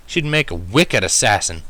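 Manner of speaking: usual speaking voice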